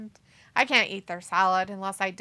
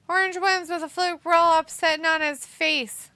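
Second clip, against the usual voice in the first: excited; 6 semitones higher, 5 dB louder than usual. Real, not acted. Announcing the match result.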